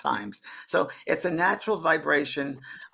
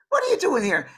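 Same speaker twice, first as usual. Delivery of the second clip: high, louder imitated lady; Scottish lady; 13 semitones higher, 5 dB louder than usual. Spirit lady questioning the little girl, high put-on voice. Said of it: "a lady from Scotland".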